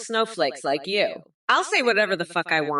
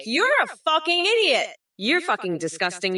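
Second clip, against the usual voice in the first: normal talking voice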